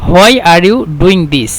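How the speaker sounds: slow and careful; slow English gloss. Shown as a demonstration. Teacher glossing the Egyptian phrase slowly in English. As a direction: spell it out slowly for learners. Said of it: "وهنا".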